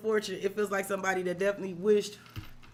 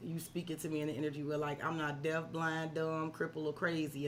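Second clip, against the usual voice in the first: imitating Maybelline; 6 semitones lower, 9 dB quieter than usual. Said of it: "but I just heard".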